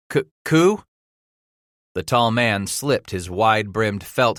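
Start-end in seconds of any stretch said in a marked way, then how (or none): 0.1-0.8 startled stammer. Acted. Captive startled by word.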